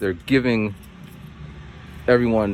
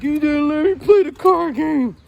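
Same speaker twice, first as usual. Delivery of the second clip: put-on high, whining child's complaint; sulking boy whines at the teacher; whiny kid; 14 semitones higher, 4 dB louder than usual. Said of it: "he's looking like".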